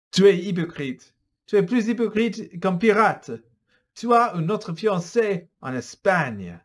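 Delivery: loud, high angry charge; furious accusation. Acted. Philippe exposing Antoine's secret, loud and angry. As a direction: spit out the accusation. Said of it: "Philippe est furieux".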